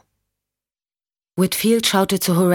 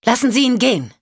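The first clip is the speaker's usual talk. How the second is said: sharp command; 7 semitones higher, 3 dB louder than usual. Acted. Michelle aims gun, orders release.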